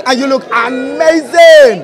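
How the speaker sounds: high, loud gushing praise; excited shout. Real, not acted. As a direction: shout the compliment with delight. Gushing compliment to a guest.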